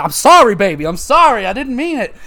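high, loud apologetic imitation; mock pleading girlfriend. Gamer imitating the crazy girlfriend begging CJ, high mock plea. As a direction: beg forgiveness in a hammed-up high voice.

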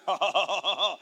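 loud villainous cackle; booming devil's laugh, acted; mock Satan laugh. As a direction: gloat with a rolling ha-ha-ha. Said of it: "Satan came and said".

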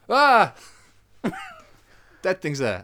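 high, loud dopey hammed-up voice; mock character line. Sending up the film's flashback for his co-host, hammed-up high and loud. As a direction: ham up a clueless character. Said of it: "walking in the woods and going".

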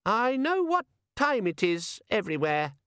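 high-pitched imp venturing an offer; high, tentative little demon; tentative gadget demon. A narrator's reading. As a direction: pipe up hopefully in a tiny high voice. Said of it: "it ventured".

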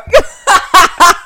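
big, high burst of laughter; loud cackling laugh. Real, not acted. Friend asks why she is crying, loud high laughter. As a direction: crack up loudly for real.

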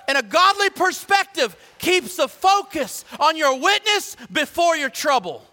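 high, loud driving sermon cadence; impassioned preacher at full voice. Acted. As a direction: build the list to a ringing charge.

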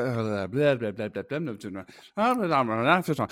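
babbled Sims gibberish; Sims impression. Podcaster clowning about being a nerd, Sims impression. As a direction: chatter in mock Sims nonsense syllables. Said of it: "get my Sims impression out of the way".

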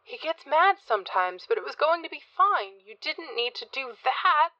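high, pleading protest; distressed protest. A narrator's reading. Addie to Rich defending Alex, high distressed protest. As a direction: plead upward, dragging out the end.

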